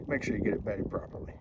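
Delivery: ordinary talk, nothing marked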